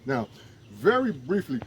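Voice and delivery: ordinary delivery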